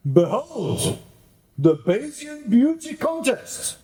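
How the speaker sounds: high, ringing proclamation; grand announcement. Acted. Villain unveiling the contest, high showman proclamation.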